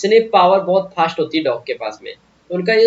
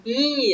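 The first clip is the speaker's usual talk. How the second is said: monkey chatter; 8 semitones higher, 3 dB quieter than usual. Shown as a demonstration. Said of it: "ऐसे चिढ़ाते ना आपको monkey".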